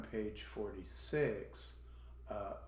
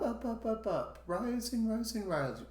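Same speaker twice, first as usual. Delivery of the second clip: "up" and "rising" held high, stepping upward; teacher climbing on each "up"; rising intonation; 11 semitones higher than usual. Shown as a demonstration. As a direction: chant "up, up, rising" at a raised pitch. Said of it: "It's going to be just up".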